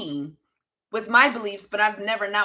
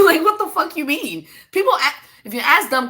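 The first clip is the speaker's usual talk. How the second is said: laughing exasperation; 7 semitones higher, 6 dB louder than usual. Real, not acted. Laughing, venting at a dumb question.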